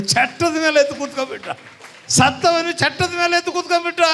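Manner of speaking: high, fast proclamation; excited preaching. Real, not acted. Preacher proclaiming the dead man sat up, excited preaching. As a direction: cry out the miracle in a rush.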